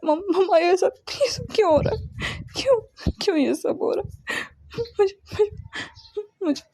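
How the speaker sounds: high, breaking, tearful begging; sobbing plea. Acted. Son sobbing to his mother, begging through tears. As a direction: cry it out like a desperate son.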